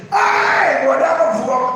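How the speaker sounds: loud, high cheering call; joyful shout. Retold or imitated. Crowd shouting as the son enters the compound, loud and high. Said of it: "everybody is shouting".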